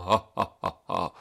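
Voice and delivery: mock villainous cackle; hammed-up evil laugh; evil monster laugh. Monsters laugh after threat.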